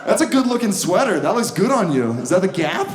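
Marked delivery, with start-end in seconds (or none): none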